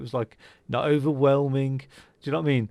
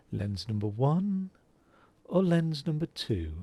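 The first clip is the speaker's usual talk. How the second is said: imitating a calm, professional optician, softer and unhurried; 4 dB quieter than usual. To a child in the chair, imitating the calm optician softly. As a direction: mimic the optician's measured, quiet lens question. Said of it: "he would literally speak like that".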